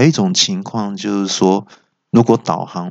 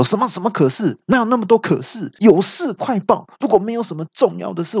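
high-pitched imperious scolding; hammed-up irritated emperor, pitched up; angry; 11 semitones higher than usual. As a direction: cut off excuses in mock anger. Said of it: "听到就很生气".

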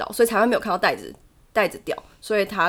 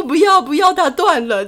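mock panicked refusal; 7 semitones higher, 5 dB louder than usual. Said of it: "我说".

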